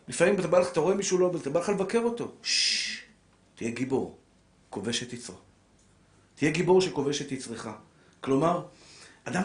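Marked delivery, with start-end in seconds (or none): 2.4-3.0 shushing hiss. Shown as a demonstration. Hushing himself.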